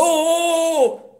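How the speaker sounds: high, loud mock greeting call; excited greeting. Friend calling out to him in the street, excited. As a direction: imitate a friend hailing him cheerfully.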